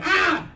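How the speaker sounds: high loud shriek; sudden high scream of fright; startled yelp. Retold or imitated. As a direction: shriek as you swat it. Squashes the spider in fright.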